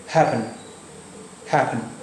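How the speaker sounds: हैपन with one p only, soft ending; single p sound. Shown as a demonstration. Teacher showing the double p sounds once. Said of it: "double पी का सिर्फ एक ही बार निकला".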